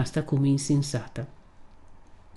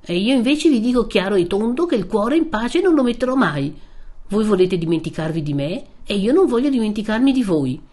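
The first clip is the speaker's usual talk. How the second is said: louder, heated defiance; angry outburst; 4 semitones higher, 4 dB louder than usual. Acted. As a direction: let the anger build to the end. Renzo's furious outburst. (sfogo rabbioso, sempre più forte)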